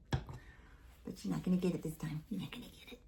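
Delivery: hushed teasing to the dog; murmured tease; soft teasing. Real, not acted. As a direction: tease him quietly.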